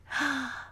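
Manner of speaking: a quiet, high intake of breath in awe; Mary gasping as she steps into the garden; soft gasp of wonder. A narrator's reading. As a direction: catch your breath at the sight. Mary enters the secret garden.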